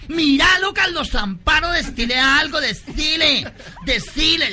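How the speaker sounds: high, loud, swooning squeal; theatrical shriek of admiration; campy excited admirer. Acted. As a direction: gush and egg a friend on, hammed up. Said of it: "le dicen al otro".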